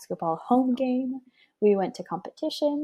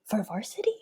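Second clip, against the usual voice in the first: stunned disbelief; 7 dB louder than usual. Retold or imitated. Stunned at name called.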